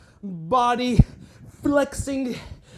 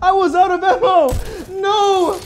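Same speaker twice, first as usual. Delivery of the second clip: panicked yelling; 4 semitones higher, 4 dB louder than usual. Real, not acted. Panicked, out of ammo.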